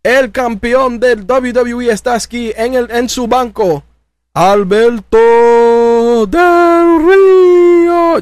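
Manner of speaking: Spanish ring announcer, building to a big high shout; grand ring introduction rising to a stretched yell on Del Rio; Ricardo Rodriguez ring announcer. Retold or imitated.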